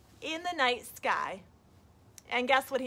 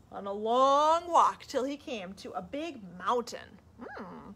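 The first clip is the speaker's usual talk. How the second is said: usual speaking voice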